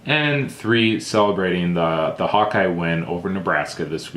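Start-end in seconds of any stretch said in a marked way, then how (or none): none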